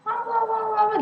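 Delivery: put-on high, repeated baby babble; babbling baby. Teacher imitating a child's unclear chatter, babbling. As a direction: babble like a child not yet forming words. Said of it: "Walaupun dia ngoceh".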